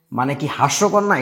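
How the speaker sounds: normal talking voice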